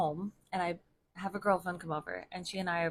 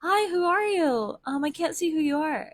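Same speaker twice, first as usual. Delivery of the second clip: wary greeting; 10 semitones higher, 6 dB louder than usual. Retold or imitated. Woman calling to a stranger in the dark, uneasy. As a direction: call out into the dark. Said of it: "I'm like".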